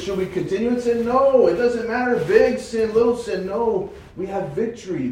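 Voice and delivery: high, loud, building emphasis; preaching with rising fire; fervent preaching. Real, not acted. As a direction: hammer the no home.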